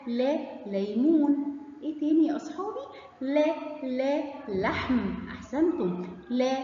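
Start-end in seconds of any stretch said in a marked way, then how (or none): 0.1-1.6 لا ليمون said slowly, لا stretched and stressed; slow sound drill. Shown as a demonstration. Teacher sounding out لا ليمون slowly for children. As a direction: draw out لا before ليمون, slowly.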